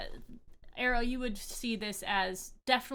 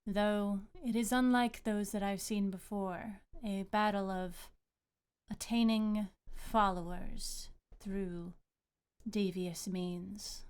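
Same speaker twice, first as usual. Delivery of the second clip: calm goddess; 3 dB louder than usual. Acted. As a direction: answer as a calm deity. GM voices water goddess.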